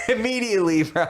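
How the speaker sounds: ordinary talk, nothing marked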